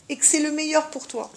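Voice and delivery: usual speaking voice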